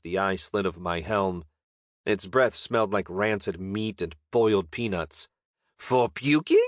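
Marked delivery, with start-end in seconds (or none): 5.8-6.6 higher goblin voice, rising to a squeak; pleading goblin. Acted. Goblin bargains for eye holes, high and rising. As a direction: ask hopefully, pitch climbing to the top.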